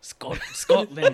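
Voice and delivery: very high, slow hammed-up Scottish accent. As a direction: squeal Scotland in an overdone Scottish accent. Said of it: "do a Scottish accent".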